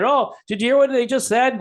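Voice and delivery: mock-excited libertarian. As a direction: gush like a thrilled fan. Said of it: "they get really excited".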